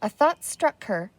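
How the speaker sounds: ordinary delivery, nothing marked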